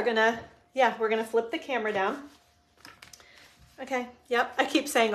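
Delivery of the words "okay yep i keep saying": ordinary talk, nothing marked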